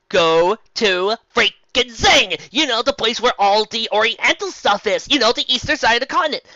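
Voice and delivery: loud exasperated rant. Real, not acted. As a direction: vent at the fanfic. Ranting complaint.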